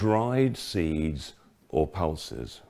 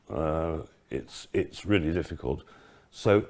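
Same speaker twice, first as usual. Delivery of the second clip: usual speaking voice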